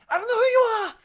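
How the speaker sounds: high, loud yell while fleeing; panicked shout. Acted. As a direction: shout it at a stranger chasing you. Fleeing character shouts at her pursuers.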